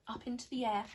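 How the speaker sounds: plain reading voice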